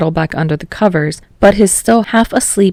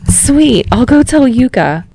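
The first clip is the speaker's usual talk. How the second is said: excited; 7 semitones higher, 4 dB louder than usual. Acted. Kirishima excited.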